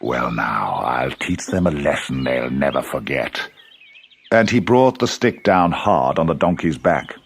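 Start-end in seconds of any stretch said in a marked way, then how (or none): 0.0-3.4 low, menacing farmer voice; angry farmer. A narrator's reading.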